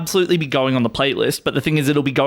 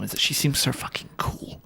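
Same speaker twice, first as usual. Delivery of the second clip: hushed conspiratorial whisper; an unvoiced, hushed aside; 9 dB quieter than usual. Real, not acted. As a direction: drop to a whisper as if sharing a secret. Confiding aside about the singer.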